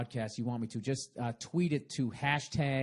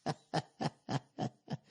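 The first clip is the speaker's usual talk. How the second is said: wheezy breathy laugh; 3 semitones higher than usual. Real, not acted. Laughs at his own bit.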